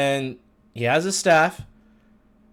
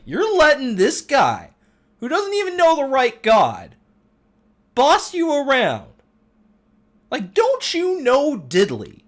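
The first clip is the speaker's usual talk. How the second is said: put-on sassy teen; 11 semitones higher, 5 dB louder than usual. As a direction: talk back to the grown-ups like a sassy teenager.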